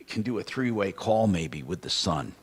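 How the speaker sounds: ordinary talk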